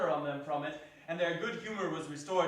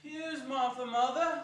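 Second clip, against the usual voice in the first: put-on higher, cheering kids; excited children; 7 semitones higher, 4 dB louder, slower than usual. The small Cratchits cry out to their mother, high and excited. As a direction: cry it out like little ones. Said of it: "cried the two young Cratchits".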